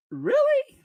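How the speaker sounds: very high-pitched disbelief; incredulous. Real, not acted. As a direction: squeak it in real disbelief. Disbelief at word's definition.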